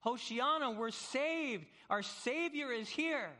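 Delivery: high, loud cry of relief; elated castaway cheer, pitched high; joyful shouting. Acted. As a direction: shout the news that rescue has come. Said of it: "You are actually shouting".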